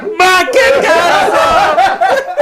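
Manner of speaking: very high, stretched-out joyful holler; imitated group cheer. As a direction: whoop it like a delighted crowd answering back. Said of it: "in unison, all five or six".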